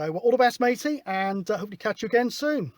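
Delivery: normal talking voice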